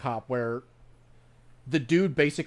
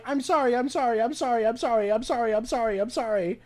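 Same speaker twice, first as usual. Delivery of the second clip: frantic apologizing; 8 semitones higher than usual. Retold or imitated. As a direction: hammer the sorries like a cop in a panic. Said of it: "starts going".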